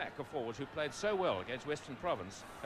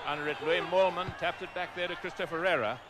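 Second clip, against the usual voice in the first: loud, higher live commentary as play starts; excited kickoff call; 4 semitones higher, 7 dB louder than usual. Real, not acted. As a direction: call the kickoff with rising energy. Kickoff, commentator calls the action.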